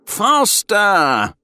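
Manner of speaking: high, loud urging cry; exuberant yell. A narrator's reading. Rider yelling to his friend at a gallop, urging. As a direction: yell it out to spur the horses on. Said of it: "Bertie yelled as his horse".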